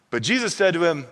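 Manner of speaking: normal talking voice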